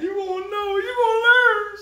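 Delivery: high, loud mock-crying wail. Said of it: "Y'all made me put my crying voice on".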